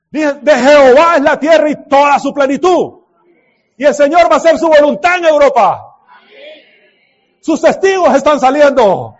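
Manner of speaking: fiery sermon climax; full-force preaching. Real, not acted. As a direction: proclaim it to the whole hall.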